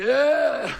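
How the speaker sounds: long, high, loud whoop; mock cheering yell. Imitating Rob Stone holding up a beer, drawn-out cheering yell. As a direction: holler it like a fan hoisting a beer.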